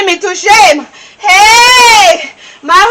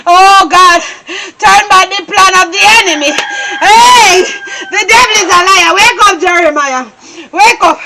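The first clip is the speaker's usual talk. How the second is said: crying prayer. Acted. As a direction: pray and cry out for him. Wife praying and wailing over husband.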